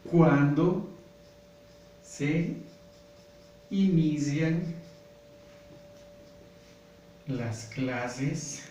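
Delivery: slow, word by word with long pauses; dictating while writing. Shown as a demonstration. Teacher dictating the question to class while writing, slow and spaced. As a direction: spell it out slowly as you write it on the board. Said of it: "Voy a escribir esta oración".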